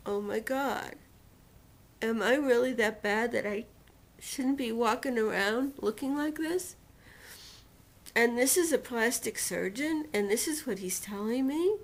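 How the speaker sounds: a touch of tearful despair; mild imitation of sobbing disbelief; retold crying. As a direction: relive the hurt, voice near breaking. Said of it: "I was crying hysterically at that point".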